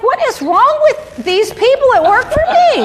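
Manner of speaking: high, incredulous mock outrage; retold exasperation. Boss telling viewers about the people who work for her, exasperated. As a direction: wail it in comic disbelief.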